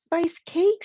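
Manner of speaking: Aunt Myra lights up at the spice cake; high, loud delighted cry. A narrator's reading.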